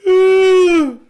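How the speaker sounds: a heavy, loud groaning sigh; loud exasperated sigh. Real, not acted. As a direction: let out a big fed-up sigh. Frustration after failed search.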